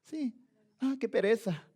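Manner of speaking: soft, lazy mock reluctance; lazy indifference. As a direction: brush it off like it's too much bother. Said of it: "tomamos el reino de Dios así como".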